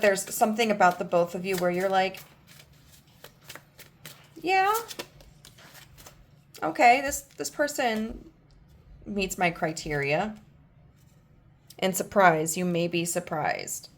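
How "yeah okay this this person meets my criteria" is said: grudging approval. Pretend. Voicing the partner's weighing thought.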